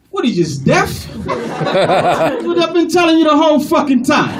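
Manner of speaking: indignant Italian-American caller; exasperated caller. Acted. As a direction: explode at the clueless agent. Caller fed up with the agent.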